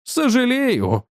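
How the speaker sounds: a cold, haughty reply to a rude friend. A narrator's reading. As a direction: drag out «Сожалею» with frosty disdain. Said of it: "процедил я холодно и надменно".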